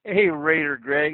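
usual speaking voice

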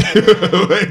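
short high laugh; quick amused laugh. Real, not acted. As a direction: burst out laughing. Laughing at McCaffrey joke.

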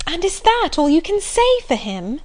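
a touch of indignation; indignant. Acted. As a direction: demand it, offended on his behalf. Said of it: "cried Marianne indignantly".

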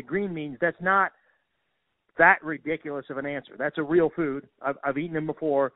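ordinary talk

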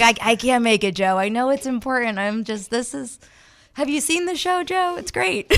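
high, breezy mock excuse voice; airy fake apology to Joe; mock celebrity excuse. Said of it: "It's like".

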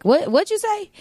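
high, disbelieving what'd you say; incredulous. Retold or imitated. At the nail salon, asking the man to repeat, high and incredulous. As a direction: act like you misheard the price. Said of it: "I'm like".

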